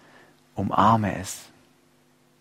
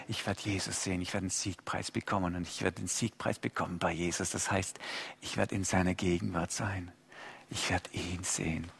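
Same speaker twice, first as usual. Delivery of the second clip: leise Sehnsucht; 7 semitones lower, 14 dB quieter than usual. Retold or imitated. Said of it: "Wo er sagt".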